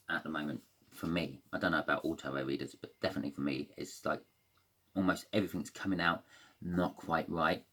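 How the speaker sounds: usual speaking voice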